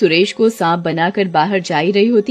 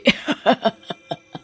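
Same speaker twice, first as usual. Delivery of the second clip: evil laugh; 2 semitones higher, 4 dB quieter than usual. Acted. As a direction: laugh at the coming death. Serpent woman gloats. (नागिन की दुष्ट हँसी)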